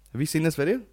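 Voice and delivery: ordinary talk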